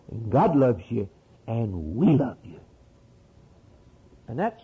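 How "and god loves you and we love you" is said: lightly mimicked preacher sign-off, pitched low; lightly mock a smiling television sign-off. Imitating television preachers closing their program, mock smiling sign-off. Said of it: "smiles and says".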